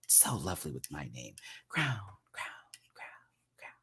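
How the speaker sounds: whispered, savoring the name; dreamy whisper. Real, not acted. Trying out her crown name, dreamy whisper. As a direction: breathe the name like a treasure.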